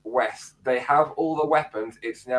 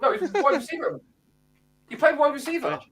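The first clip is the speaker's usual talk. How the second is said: insisting; 12 semitones higher, faster than usual. Real, not acted. Insisting in a stats argument.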